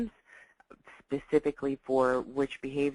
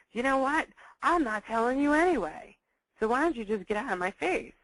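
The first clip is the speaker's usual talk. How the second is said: higher, louder defiant brush-off; defiant teen; 8 semitones higher, 5 dB louder than usual. Retold or imitated. Teen rejecting a pushy parent, defiant and loud. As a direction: snap back and shut the parent out. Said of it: "he's gonna say".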